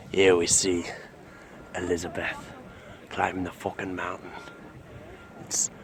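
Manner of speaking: hushed, low wildlife narration; nature documentary narrator. Acted. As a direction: murmur like a nature film host.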